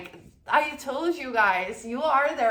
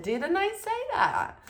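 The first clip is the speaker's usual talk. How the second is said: smug boast; 11 semitones higher than usual. Real, not acted. Boasting about prediction.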